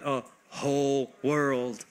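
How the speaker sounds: WHOLE and WORLD each stretched long, with space between; drawn-out emphasis. Shown as a demonstration. Preacher answering "it is a what?", stretching WHOLE WORLD. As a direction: slowly weigh out "whole world", word by word.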